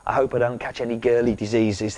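ordinary talk

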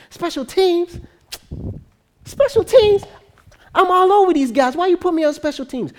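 acted outrage in a high-pitched voice; incredulous protest. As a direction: play a disbelieving, insulted player. Player outraged at special teams assignment.

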